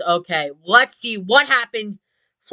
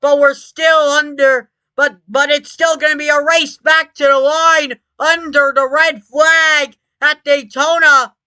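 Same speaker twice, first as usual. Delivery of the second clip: motorsport commentator; 6 semitones higher, 5 dB louder than usual. Acted. Live race play-by-play after crash.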